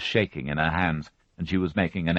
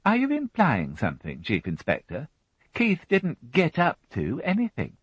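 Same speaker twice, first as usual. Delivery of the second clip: lightly raised fussy housewife voice; a higher, brisk widow answering the policeman; Mary Rothwell voiced; 10 semitones higher than usual. A narrator's reading. Widow answering the inspector, a higher fussy voice.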